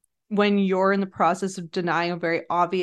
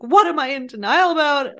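higher, louder mock indignation; indignant; 5 semitones higher, 5 dB louder than usual. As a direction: demand it as if affronted. Voicing Kyle's indignant question.

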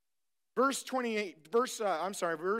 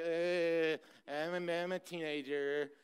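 a hint of a shy mumbling teen, lower and softer; mock the bashful teen, trailing off; timid teenager; 5 semitones lower, 6 dB quieter than usual. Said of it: "really timid age. Like".

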